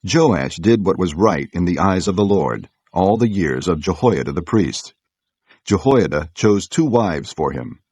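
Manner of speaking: ordinary delivery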